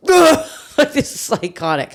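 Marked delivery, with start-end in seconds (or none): 0.1-0.5 high loud groan of dismay; loud disgusted ugh. Retold or imitated. Agent watching the jump, loud disgusted groan. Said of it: "Joe's like".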